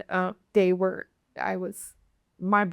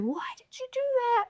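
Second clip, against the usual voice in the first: retold scream; 14 semitones higher, 5 dB quieter than usual. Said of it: "and I was screaming".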